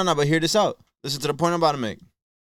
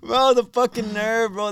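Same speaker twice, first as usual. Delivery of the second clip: incredulous outrage; 7 semitones higher, slower than usual. Real, not acted. Outraged at the article.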